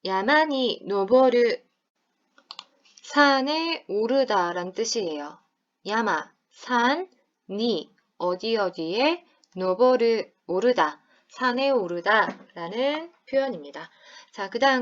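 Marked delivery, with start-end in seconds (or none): 0.0-1.6 야마니 노보루 slow, stress on 노보루; slow model. Shown as a demonstration. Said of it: "다시". 5.9-11.0 slow. Shown as a demonstration. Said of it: "breaking down phrase".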